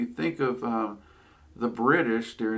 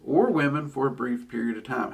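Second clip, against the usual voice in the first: ordinary delivery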